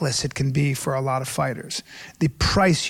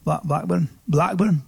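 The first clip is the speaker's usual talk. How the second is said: attempted northern English accent. Shown as a demonstration. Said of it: "What about Blackburn?".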